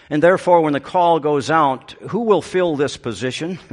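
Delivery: loud, rousing pulpit preaching; fiery preaching. Acted. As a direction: preach it out with full force. Preaching at full force.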